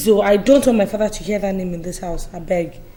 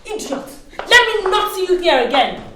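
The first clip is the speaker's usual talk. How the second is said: high, heated send-off; angry insult; 7 semitones higher than usual. Acted. Host banishing her former friend, angry. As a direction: hurl it after them in anger.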